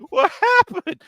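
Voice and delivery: very high, loud falsetto mom voice; mock mom squeal. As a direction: squeal like an amazed mother.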